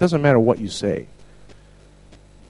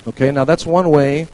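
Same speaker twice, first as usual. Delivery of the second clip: normal talking voice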